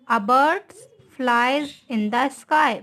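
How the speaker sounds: reads the sentence very slowly, word by word; slow dictation. Shown as a demonstration. Teacher dictating an example sentence to students, very slow. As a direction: spell it out slowly for the class. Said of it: "teacher reads example sentence slowly".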